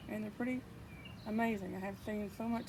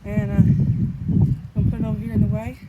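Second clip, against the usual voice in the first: raised voice against the wind; shouting over wind; 13 dB louder than usual. Real, not acted. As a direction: project over the gusts. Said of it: "I hope you could hear me over the wind".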